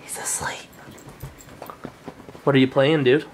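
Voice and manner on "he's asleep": whispering. Real, not acted. Whispering near sleeping baby.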